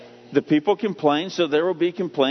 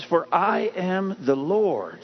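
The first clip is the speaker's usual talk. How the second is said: slow declaration; much slower than usual. Acted. Quoting God, slow emphasis.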